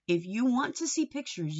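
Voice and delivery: normal talking voice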